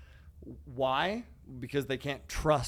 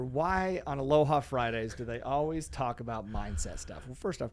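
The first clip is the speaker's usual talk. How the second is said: ordinary talk, nothing marked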